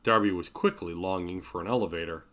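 plain reading voice

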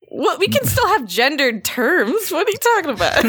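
high mock indignation; mock outrage. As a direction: play it as fake outrage.

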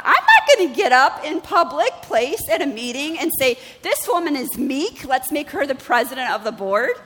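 indignant protest. Retold or imitated. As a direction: balk at saying it in public.

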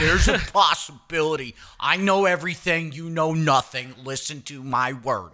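Doctor Phil. Retold or imitated. Teasing friend's Doctor Phil answer, mock-pompous impression. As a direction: pronounce it like an all-knowing TV therapist. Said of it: "Doctor Phil me right now".

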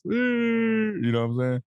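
emphatic drawn out. Real, not acted. Driving the point home to his friend, high and slow.

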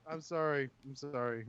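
faint distant apology; far from mic. Real, not acted. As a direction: speak from across the room. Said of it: "You're so far away".

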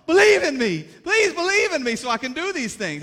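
high, loud pleading fairy voice; mock Tinkerbell. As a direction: beg like a tiny fairy, hammed up. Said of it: "like he's Tinkerbell".

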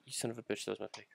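very soft, resigned cursing; quiet grumble. Real, not acted. Grumbles over his stolen pick.

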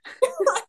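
high-pitched burst of laughter. Real, not acted. Laughing at Coldplay story.

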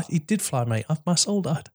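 ordinary talk, nothing marked

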